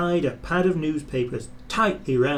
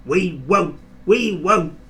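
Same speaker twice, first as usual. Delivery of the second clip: higher, louder defiant chant; screaming trucks; 6 semitones higher, 6 dB louder than usual. Acted. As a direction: yell it back in stubborn defiance. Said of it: "screamed the trucks".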